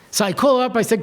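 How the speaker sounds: normal talking voice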